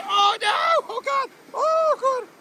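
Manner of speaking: very high, panicky yelps; real ride alarm. Real, not acted. Rider fearing a soaking, high alarmed cries. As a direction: yelp high as the danger nears.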